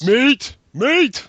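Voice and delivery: a high, loud, sing-song jab repeating the word "Meet"; mocking repeat. A friend loudly mocking the misread word. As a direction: needle the reader by echoing "Meet" loudly.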